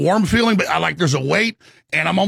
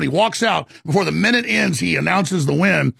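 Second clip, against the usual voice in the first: usual speaking voice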